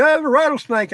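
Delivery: high imitation of the dad's alarmed answer. Dad answering his son about the snake, high mock alarm. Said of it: "He said".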